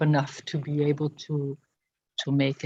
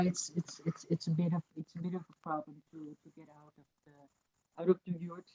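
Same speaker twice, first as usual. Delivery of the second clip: drifting off the microphone, much softer; fading away; 12 dB quieter than usual. Real, not acted. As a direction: voice drops away from the mic. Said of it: "Every now and then your voice fades away".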